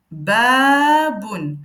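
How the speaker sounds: "Baboun" said slowly and clearly; model word. Shown as a demonstration. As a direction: pronounce "Baboun" for learners to copy. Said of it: "je vais venir prononcer chaque lettre".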